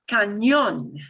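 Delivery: Cañón with the ny sound of onion, punched on -ñón; modeled ñ sound. Shown as a demonstration. Teacher models Cañón for repetition, ñ like the ny in onion. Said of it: "it'll sound like this, and I'll model them first".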